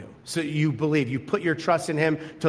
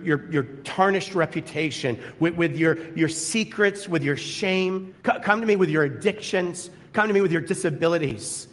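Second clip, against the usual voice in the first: higher, rising pleading preacher's call; impassioned preaching; 4 semitones higher than usual. Acted. Pastor voicing Jesus' call to the congregation, impassioned preaching. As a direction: plead with the room to come.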